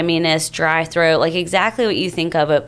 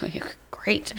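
whisper; 2 semitones higher, 11 dB quieter than usual. Retold or imitated. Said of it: "And he's like".

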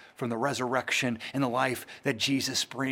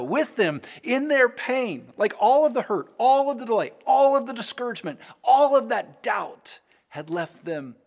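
higher, louder, building preacher's cry; impassioned preaching; 7 semitones higher, 5 dB louder than usual. Acted. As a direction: preach it with rising fervor. Preaching rises to full force.

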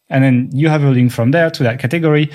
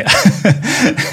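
higher-pitched chuckle at his own idea; amused laugh; 7 semitones higher than usual. Real, not acted. Host pitching a lip-sync video to co-host, amused laugh. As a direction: laugh at your own silly suggestion.

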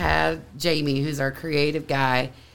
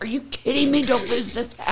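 retold disbelief; 6 semitones higher, faster than usual. Acts out friend's alarm about hat.

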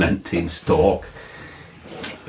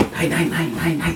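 quick refusal; 11 semitones higher than usual. Acted.

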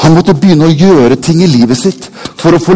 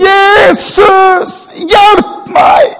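desperate shout, far higher and louder; shouting again; 4 dB louder, much slower than usual. A narrator's reading. Blind beggar shouting again to Jesus, desperate. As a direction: cry out to Jesus once more. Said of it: "han roper på nytt igjen".

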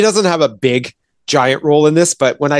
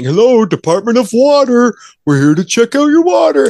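mock cover story; 10 semitones higher, 4 dB louder than usual. Mocking cops' fake cover story.

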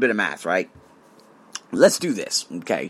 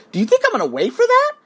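indignant disbelief; 3 dB louder, faster than usual. Retold or imitated. Retold indignant reply to staff.

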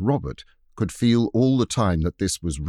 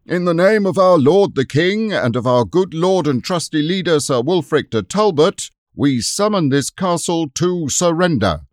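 loud, high formal proclamation; a herald calling on the castle to yield; herald's summons; 7 semitones higher, 8 dB louder than usual. Acted. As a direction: proclaim the demand to surrender. Herald summons castle across moat.

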